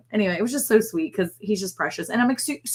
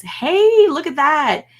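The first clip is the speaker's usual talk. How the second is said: high, loud delighted call; light up as a friend joins the chat; excited greeting; 10 semitones higher, 7 dB louder, much slower than usual. Real, not acted. Host spots a viewer in chat, delighted high call.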